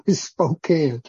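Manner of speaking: Spokane ending like "can", after a chuckle; amused short-A Spokane, rhymes with "pan"; corrected Spokane. Shown as a demonstration. Preacher owning up to being corrected, Spokane with a short A. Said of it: "I've been corrected by that more than once".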